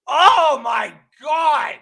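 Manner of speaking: slow, drawn-out loud groan of Oh my God; exasperated shout. Real, not acted. Raging at the Saints on stream, slow shout. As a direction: let it out, fed up. Fan rant exclamation.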